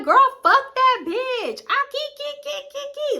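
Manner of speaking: very high squealing key key key; mock laughing. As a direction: imitate her shrill giggling.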